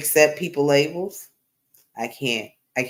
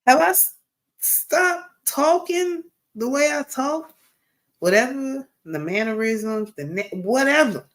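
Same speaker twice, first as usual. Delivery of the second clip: indignant; 11 semitones higher than usual. Real, not acted. Indignant question to chat.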